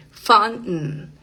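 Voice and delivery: "fountain" with the T stopped in the throat; glottal T. Shown as a demonstration. Teacher giving an example word, glottal T. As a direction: end "fountain" on a nasal n, T unreleased. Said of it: "Esa es una T glotal".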